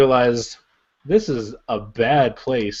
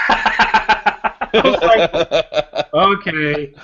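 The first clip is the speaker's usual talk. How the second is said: high, stretched laugh; cracking up while telling it; 5 semitones higher, much slower than usual. Real, not acted. Laughing at the son's text.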